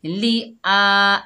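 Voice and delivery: ली आ stretched a full beat; ली आ slowly drawn out as recited; drawn-out vowel. Shown as a demonstration. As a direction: hold the vowel of आ long and loud. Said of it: "एक अलिफ की मिकदार खींचकर पढ़ेंगे".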